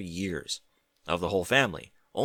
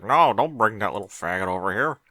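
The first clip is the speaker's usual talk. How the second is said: mock Applejack; 6 dB louder than usual. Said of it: "Applejack's like".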